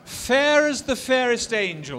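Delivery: loud, high, drawn-out romantic declamation; heartfelt romantic ardor. Shown as a demonstration. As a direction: declaim it slowly with swelling ardor. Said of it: "a bit more heartfelt".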